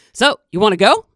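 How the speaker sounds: loud, eager little creature; groundhog voice. Acted. Groundhog speaks up suddenly.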